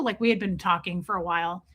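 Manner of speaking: ordinary talk, nothing marked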